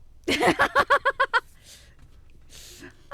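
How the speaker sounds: high, loud cracking-up laugh; hysterical laugh. Real, not acted. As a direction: burst out laughing. After fail.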